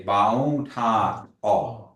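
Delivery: Ba on ta aw, slow, final aw held; slow Kiowa phrase. Shown as a demonstration. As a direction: sound out Ba on ta aw with a long aw. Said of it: "So you say".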